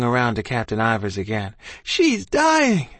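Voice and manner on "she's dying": very high desperate holler. A narrator's reading. Boy hollering at the captain about his ma, desperate. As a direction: holler it out, pitch soaring. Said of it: "I hollered".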